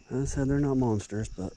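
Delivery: ordinary delivery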